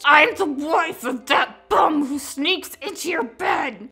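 slow, seething hiss of a jealous wife; jealous wife hissing accusations at the detective. Acted. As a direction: spit it out slowly between clenched teeth. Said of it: "she hissed".

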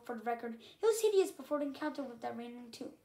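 ordinary delivery, nothing marked

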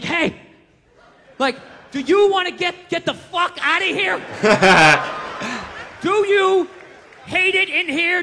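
high, emphatic, spelled-out questions; urgent questioning. Acted. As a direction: put it insistently to a captive gorilla. Acting someone signing urgently to gorilla.

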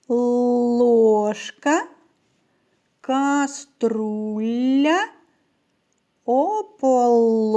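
each word said very slowly, the Л in "Ложка" and "тарілка" held long; drawn-out L sound. Shown as a demonstration. Teacher checking words with Л for children, drawing out "Ложка" and "кухлик" sound by sound. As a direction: stretch the Л sound in "Ложка, каструля, ополоник". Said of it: "у яких знаходяться звуки Л".

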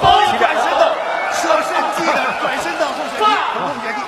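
loud, high excited commentator yell; excited shout. Real, not acted. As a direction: shout the spinning back kick. Commentator sees spinning kick.

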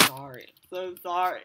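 mock apology.

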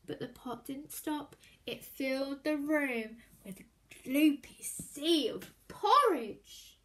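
plain reading voice

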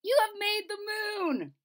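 high, slow proud jester. Acted. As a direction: announce it with a flourish. Jester voiced.

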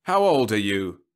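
lightly voiced salesman robot; robot salesman to child. A narrator's reading. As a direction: loudly quiz a youngster.

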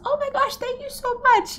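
high, gushing thank-you; grateful neighbour. Retold or imitated. Neighbour thanking her for fresh eggs, high and gushing. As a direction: gush your thanks up high.

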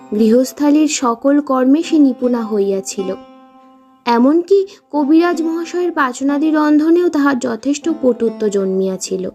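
ordinary delivery

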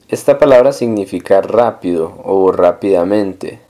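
normal talking voice

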